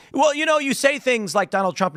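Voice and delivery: high, rattled mock reporter changing the subject; hammed-up cornered reporter; flustered journalist. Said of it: "And he's like".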